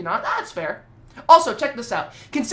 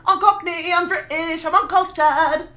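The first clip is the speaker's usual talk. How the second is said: put-on Cockney accent again, a touch higher, slow at the end; mock Cockney; 6 semitones higher, 4 dB louder than usual. Streamer repeating his boast in mock Cockney accent. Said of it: "I'm still doing this voice".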